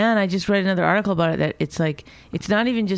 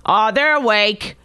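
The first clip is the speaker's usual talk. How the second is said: mocking; 6 dB louder, slower than usual. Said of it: "So you think, like".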